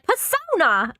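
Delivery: loud, high Brooklyn shout of Persona; Brooklyn accent. Retold or imitated. Imitating her brassy Brooklyn yell to the party. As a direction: belt it out like a Brooklyn tough. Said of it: "And then she's like".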